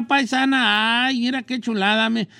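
ordinary talk

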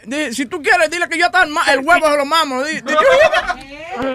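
loud, laughing bravado; a hammed-up loud boast through laughter; mock shouting. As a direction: yell it out, hamming it up. Shouting back in prank call.

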